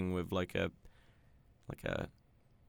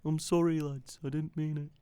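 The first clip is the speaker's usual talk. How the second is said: higher-pitched, badly done Brummie apology; attempted Brummie accent; 8 semitones higher than usual. Co-host mock-apologises as the absent friend, bad Brummie accent. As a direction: have a shaky go at a Brummie accent. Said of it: "I can't do a Brummie accent".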